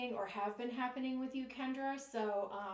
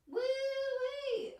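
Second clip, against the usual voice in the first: a much higher Southern "Woo-wee!" whoop; playful Southern exclamation, much higher; mock southern accent; 4 dB louder than usual. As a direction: holler it like a hammed-up Southern belle. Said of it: "I don't know what I'm doing with this southern accent".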